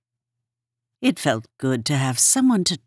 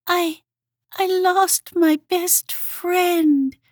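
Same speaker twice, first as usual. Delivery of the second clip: high, slow, tearful little voice; whimpering Hedgehog; 3 dB louder, slower than usual. A narrator's reading. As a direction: whimper through tears. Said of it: "she whimpered".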